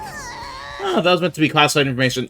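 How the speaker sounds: formal explanation breaking into alarm; hammed-up exasperation at own slip; flustered theatrical schemer. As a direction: explain primly, then catch yourself. Character lets secret slip again.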